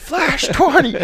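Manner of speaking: very high, loud mock scream; retold scream. Retelling yelling the pack's name in the barn, high mock scream. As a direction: yell it in a strained falsetto. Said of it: "I was screaming in my barn".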